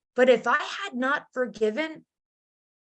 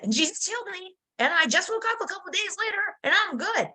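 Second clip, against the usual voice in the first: joyful telling; 8 semitones higher, faster than usual. Retold or imitated.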